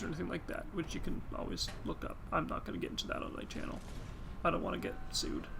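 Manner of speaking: hushed unvoiced aside; whispering. Real, not acted. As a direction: whisper it like a secret. Aside about lawsuits.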